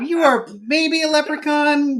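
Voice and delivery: high-pitched cartoon Irish leprechaun; Irish character voice. Shown as a demonstration. Dad voicing kids' book character, high Irish voice. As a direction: put on a high Irish storybook voice. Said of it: "Irish Beat Cop from a nineteen thirties movie".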